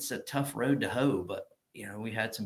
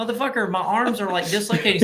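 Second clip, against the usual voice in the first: high, loud strained complaint; mock-outraged shout; exasperated yell; 10 semitones higher, 7 dB louder than usual. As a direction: yell it like your arms are giving out. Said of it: "I'm like".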